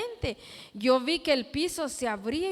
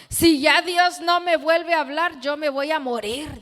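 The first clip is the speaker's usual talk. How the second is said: loud, raised-pitch retold cry of desperation; desperate outcry; 3 semitones higher, 9 dB louder than usual. Telling hermanos what she said fearing God's silence, desperate outcry. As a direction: cry it out loudly, as a desperate vow.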